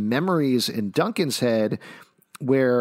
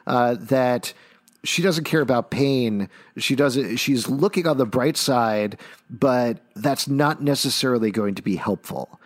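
ordinary talk, nothing marked

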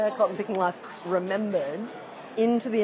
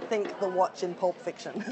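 ordinary talk, nothing marked

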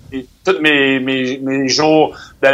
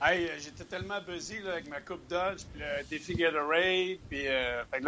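very quiet; 2 semitones higher, 15 dB quieter than usual. Real, not acted. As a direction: speak very softly, barely carrying. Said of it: "mais chuchote pas".

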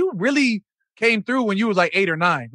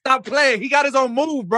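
higher, fired-up interjection; excited hype; 5 semitones higher than usual. Real, not acted. Host bragging on the fencer's own move, excited. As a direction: hype up your friend, pitched up.

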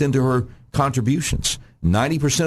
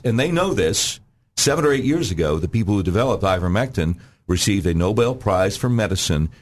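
usual speaking voice

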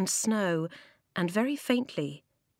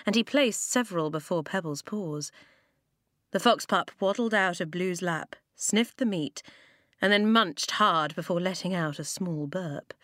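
ordinary delivery

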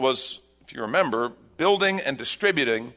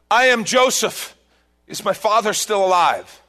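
emotional outburst; 9 semitones higher, 6 dB louder than usual. Acted. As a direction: burst out the truth at last. Joseph reveals himself, emotional.

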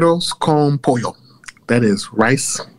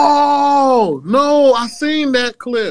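excited recognition; 12 semitones higher, much slower than usual. Real, not acted. Recognizing the viral clip.

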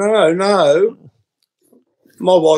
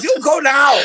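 very high, exasperated mock Chinese shopkeeper; Chinese accent; 10 semitones higher than usual. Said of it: "if you do an accent of a foreign person".

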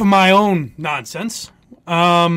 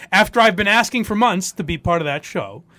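ordinary talk

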